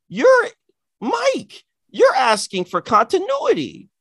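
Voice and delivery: high, sarcastic, incredulous rant; sarcastic shock at a friend wanting logic; mock outrage. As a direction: scold Mike in a mocking, sky-high voice.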